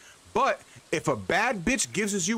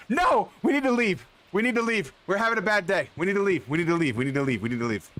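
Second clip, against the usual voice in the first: panicked retreat; 3 semitones higher, faster than usual. Real, not acted. Urgently leaving after blunder.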